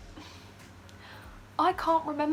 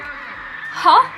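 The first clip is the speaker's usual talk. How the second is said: confused huh; 4 semitones higher, 7 dB louder than usual. Retold or imitated. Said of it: "we're like".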